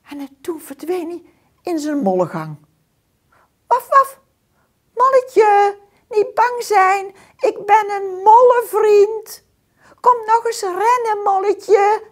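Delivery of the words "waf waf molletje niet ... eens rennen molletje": high, loud friendly call; dog calling. A narrator's reading. Dog calling the scared mole back, high and loud. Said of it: "riep ik".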